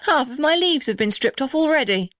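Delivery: high-pitched storybook dismay; acted cry of indignation; mock shout. A narrator's reading. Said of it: "shouted the blue gum".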